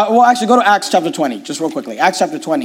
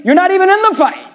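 raised, driving preacher's build; fiery preaching; 8 semitones higher than usual. Acted. Pastor urging the congregation to attend church, fiery preaching. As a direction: hammer home each point to the congregation.

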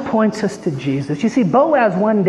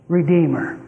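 ordinary talk, nothing marked

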